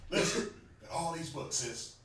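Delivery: hammed-up low-key pickup line; thirsty man sweet-talking. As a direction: lean in and sweet-talk her like a thirsty brother. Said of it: "Trying to sound thirsty".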